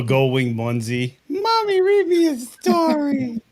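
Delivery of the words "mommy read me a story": put-on squeaky child voice, much higher and slow; whiny little kid. Teasing Dallas as a child begging Penny, squeaky. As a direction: beg like a little boy at bedtime.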